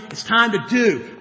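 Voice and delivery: usual speaking voice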